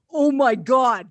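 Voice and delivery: loud, hammed-up shock; acted-out stunned reaction; mock astonishment. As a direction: exclaim it in pretend disbelief. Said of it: "like this".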